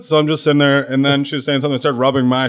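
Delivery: ordinary talk, nothing marked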